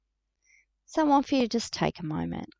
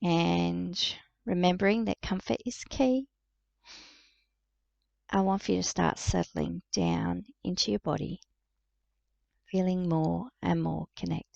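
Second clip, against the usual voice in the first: guided meditation. Real, not acted. Psychic guiding listeners to relax into breath and body, calm meditative delivery. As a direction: lead them gently inward, leaving space to breathe.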